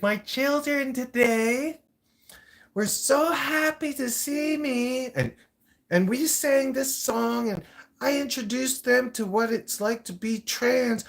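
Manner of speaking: high, slow, sing-song mock creep; very creepy voice. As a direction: imitate a creepy man, high and drawn out. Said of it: "very creepy voice".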